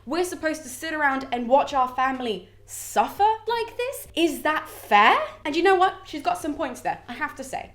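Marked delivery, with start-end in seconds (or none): 0.0-5.2 higher, slower, upset plea to her father; a daughter protesting, voice lifted; wounded goddess complaining; Venus pleading. Retold or imitated.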